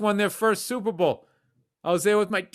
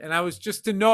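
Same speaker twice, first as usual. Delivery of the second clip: usual speaking voice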